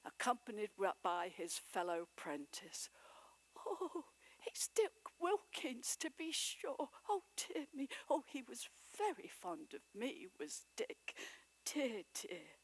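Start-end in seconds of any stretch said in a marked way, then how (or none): 3.7-12.6 put-on fond old Scrooge. A narrator's reading. Scrooge fondly recalls Dick.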